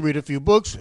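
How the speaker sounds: usual speaking voice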